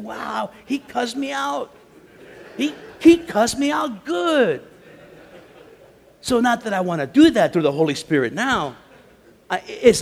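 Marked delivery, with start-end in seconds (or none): 0.0-4.6 amazed recollection. Retold or imitated. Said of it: "I said".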